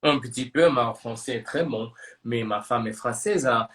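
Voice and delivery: French spoken with a pretty good French accent. Shown as a demonstration. Showing off a few rehearsed phrases to colleague, good French accent. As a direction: speak practised French in a French accent. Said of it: "with a pretty good French accent".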